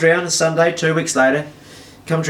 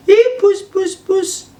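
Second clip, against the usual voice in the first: very high falsetto sing-out; calling a cat; 3 dB louder, much slower than usual. Retold or imitated. Girlfriend lures the wary cat, high coaxing. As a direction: coax the cat in a squeaky falsetto.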